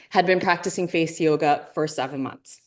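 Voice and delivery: ordinary talk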